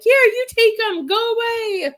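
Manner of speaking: high, loud mock-desperate plea; exasperated mom begging for relief; frazzled parent. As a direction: hand off the kids in a whiny, high-pitched cry. Exhausted parent handing kids over.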